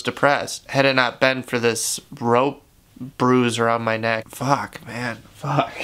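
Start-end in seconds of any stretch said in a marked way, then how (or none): 4.3-5.7 tearing up. Real, not acted. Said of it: "starting to tear up".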